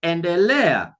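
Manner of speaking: Endelea with LE stretched and stressed; Endelea, strong third syllable LE; stress on second-last syllable. Shown as a demonstration. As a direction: push the LE in Endelea, drop on A. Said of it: "going to put a stress there".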